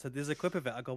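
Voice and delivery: ordinary delivery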